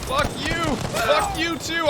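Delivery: gleeful taunt. Real, not acted. Taunting kills.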